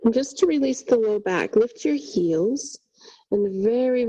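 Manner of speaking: ordinary talk, nothing marked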